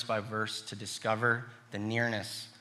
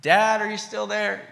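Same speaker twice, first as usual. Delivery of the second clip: put-on high, loud child's call; a small boy calling into the house; a boy calling for Dad from his bed; 9 semitones higher, 5 dB louder than usual. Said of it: "I would regularly call out".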